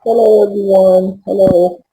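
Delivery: normal talking voice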